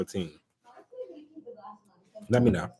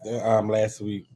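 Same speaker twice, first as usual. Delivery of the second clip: normal talking voice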